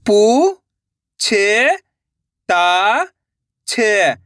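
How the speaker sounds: long drawn-out syllables with pauses between. Shown as a demonstration. Teacher sounding out a word for learners, syllable by syllable. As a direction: sound out each syllable slowly and separately. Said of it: "एक एक अक्षर का उच्चारण करके".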